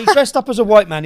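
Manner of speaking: loud, amused retelling through laughter; laughing. Real, not acted. Retelling a hilarious comedy prank.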